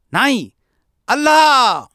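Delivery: enraged bellow, demanding it be said properly; furious shout. A narrator's reading. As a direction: yell it as if pounding the table. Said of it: "क्रोध सहन न झाल्याने त्याने आपली मूठ".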